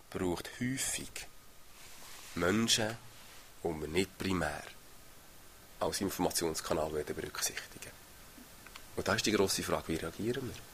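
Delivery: softly confiding preacher, trailing to a whisper; hushed sermon point. Real, not acted. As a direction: draw the congregation close with a hush. Preacher drops to intimate hush.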